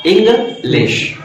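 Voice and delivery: "English" in two spaced beats; two separate syllables. Shown as a demonstration. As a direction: break "English" into इंग, pause, लिश. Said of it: "दो syllable हैं".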